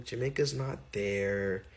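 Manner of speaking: low, slow, soft teacher correction; lightly voiced teacher, deadpan and slow; teacher calmly telling the boy he's wrong, low and slow; flat teacher reply. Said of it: "she was like".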